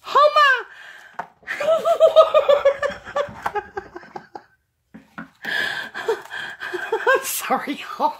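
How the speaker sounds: high squeal collapsing into laughter; helpless giggles, squeezing out an apology; giddy laughter. Real, not acted. Crafter apologising to viewers through giddy laughter. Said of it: "I'm giddy".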